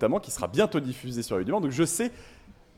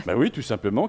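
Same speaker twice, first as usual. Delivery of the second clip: normal talking voice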